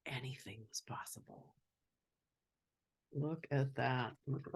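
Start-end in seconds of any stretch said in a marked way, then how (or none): 0.1-1.5 hushed, breathy storybook whisper. A narrator's reading. Reader telling the child the big moment, in a whisper. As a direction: let it fall to a whisper.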